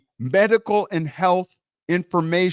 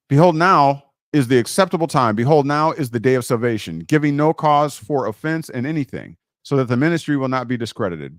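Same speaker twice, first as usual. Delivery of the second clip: scripture reading; 7 semitones lower than usual. Acted. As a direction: proclaim the verses to the congregation. Reads scripture passage aloud.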